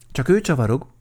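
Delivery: high, loud protest; shouting. A narrator's reading. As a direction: yell the accusation to clear yourself. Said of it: "kiabált Vacska Mati".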